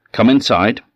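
loud, high witch command. Acted. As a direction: bark it like a witch queen. Baba Yaga orders girl in.

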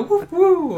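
very high hooting noise; playful hoot. Pretend. Wishing for a little owl toy to hoot, high playful hoot.

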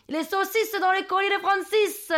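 high-pitched fake Marseille call; hammed-up southern French accent. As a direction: holler the rhyme in bad southern accent. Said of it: "Je prends mon plus bel accent du Sud". (faux accent du Sud claironné)

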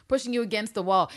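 usual speaking voice

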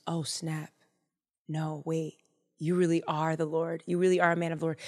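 low, hushed realization; awed belief. Acted. King realizes the man speaks for God, hushed awe. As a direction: drop the voice as it sinks in. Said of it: "J B now believes".

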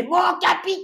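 put-on high shouted child's call; kid soldier shouting. Boy checkpoint chief calling out to the captain, high childish shout. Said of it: "on était mort de rire".

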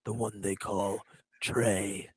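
loom over them like a dark lord. Acted. Mock warrior challenge.

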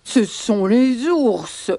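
very slow elephant accusation; protesting elephants. Acted. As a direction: drag it out like a ponderous elephant. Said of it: "protestent les éléphants".

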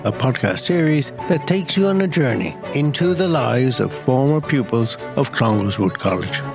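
polished, scripted intro read; podcast intro read. Acted. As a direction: read it like a show opener. Scripted podcast intro over music.

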